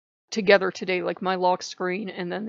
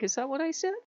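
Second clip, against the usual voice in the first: higher, feigning innocence; mock innocent; 7 semitones higher, 4 dB quieter, faster than usual. A god of death to the teller, faking innocence. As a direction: play dumb, all innocence. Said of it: "looking at me really innocently".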